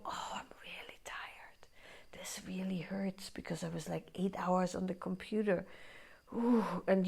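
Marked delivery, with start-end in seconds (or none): 0.0-5.6 whispered, breathless admission of fatigue; tired whisper. Retold or imitated. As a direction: whisper it like you're worn out. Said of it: "But when you go".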